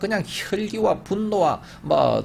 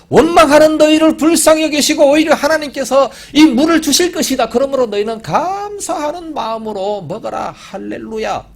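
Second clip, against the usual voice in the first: high, loud joyful announcement ending in hallelujah; gracious proclamation; 10 semitones higher, 7 dB louder than usual. Acted. Moses as he should have spoken to the people, joyful and loud. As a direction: proclaim God's mercy with joy. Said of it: "모세는 이렇게 얘기해야 됩니다".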